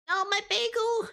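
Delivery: high, sudden cry of dismay; dismayed yelp. Real, not acted. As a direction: yelp over the lost bagel. Bagel dropped, cries out.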